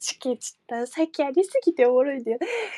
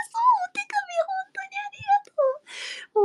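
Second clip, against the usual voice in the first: fast, voice cracking into tears; thanks that break into crying; tearful thanks; much faster than usual. Real, not acted. Thanking fans for letters, real tears breaking through.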